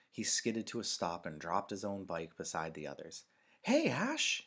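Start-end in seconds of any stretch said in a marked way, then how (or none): 3.6-4.4 high, loud call to a friend; high-pitched holler; shouted greeting. A narrator's reading. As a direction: shout out to get a friend's attention. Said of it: "he shouted".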